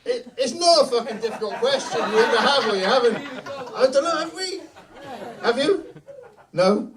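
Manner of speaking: loud, high-pitched incredulous rant; rising, high and exasperated. Real, not acted. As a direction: berate a hesitant audience member in disbelief. Exasperated with audience member's answer.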